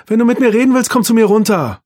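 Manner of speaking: loud, high scolding call. A narrator's reading. Mutter ruft von unten zurück, laut tadelnd. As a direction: scold from downstairs. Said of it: "rief Marie-Therese von unten".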